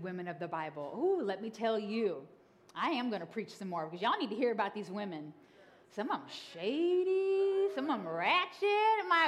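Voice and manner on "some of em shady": drawn out. Real, not acted. Drawn-out comic emphasis.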